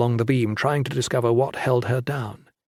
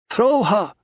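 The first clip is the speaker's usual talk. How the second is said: firm call; 9 semitones higher than usual. A narrator's reading. Lin urging Valin to throw the girl, firm call. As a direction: call it across the gap. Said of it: "she said".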